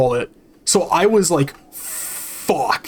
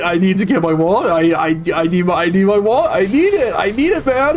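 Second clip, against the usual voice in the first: desperate plea; faster than usual. Retold or imitated. Retold desperate plea to manager.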